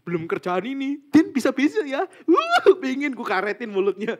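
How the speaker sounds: a loud, high, hammed-up outburst at a colleague; mock exasperation. Griping to Din about a delayed job, loud mock exasperation. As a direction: rant at the coworker who pushed the deadline back, half laughing. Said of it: "Kayak gitu loh".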